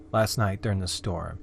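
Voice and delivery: plain reading voice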